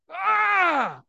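high, loud cry of joy; joyful cry. Acted. Jairus rejoicing over his daughter, loud joyful cry. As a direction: let out a delighted yell.

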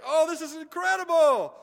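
very high, loud, gushing delight; the healed woman celebrating, high and loud; overjoyed exclamation. Acted. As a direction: burst out with joy. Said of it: "And she's like".

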